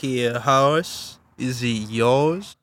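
slow, drawn-out Texan drawl; Texan accent. Pretend. Cowboy asking about a horse, mock Texan. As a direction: put on a leisurely cowboy Texan. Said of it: "like, Texas".

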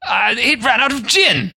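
hammed-up English accent, pitched high. Guest appalled at no gin, mock-outraged English accent. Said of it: "English Baltimore".

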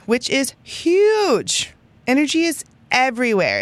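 high, drawn-out emphasis on huge; emphatic. Real, not acted. Stressing huge energy.

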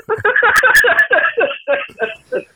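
loud laugh. Real, not acted. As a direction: burst of high laughter. Laughing at own singing.

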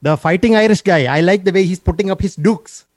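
put-on playful Indian accent; cheerful put-on Indian accent. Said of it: "be hard to maintain the accent the whole time".

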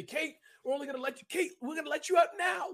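high, laughing mock jailer releasing a superhero; mock guard voice. Guest jokes about guards freeing superpowered Kate, high laughing mock voice. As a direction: squeak it out like a guard unlocking a straitjacket, laughing.

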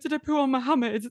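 high, mortified question; horrified asking. Retold or imitated. Patient asking husband about the doctor, high mortified question. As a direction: ask it in disbelief. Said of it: "That's what I was going".

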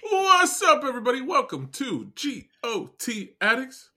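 high, loud show opener; excited intro. Acted. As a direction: greet listeners with energy. Podcast intro call-out.

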